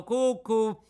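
high sing-song cucù teasing; cheeky puppet jeering cucù; mocking cuckoo. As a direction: taunt like a cuckoo clock. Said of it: "in segno di canzonatura".

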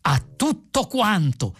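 high, slow proclamation; grand, drawn-out exclamation; exclaimed toast. A narrator's reading. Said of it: "esclamarono all'unisono".

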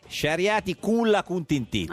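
Sciarriati culla cuntintizza slow, in broad Sicilian dialect; Sicilian accent. Shown as a demonstration. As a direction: say 'culla cuntintizza' the Sicilian way, slowly. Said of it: "glielo spiega, lo traduce".

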